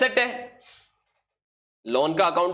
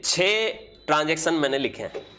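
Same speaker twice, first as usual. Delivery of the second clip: ordinary talk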